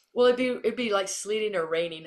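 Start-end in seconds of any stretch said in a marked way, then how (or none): none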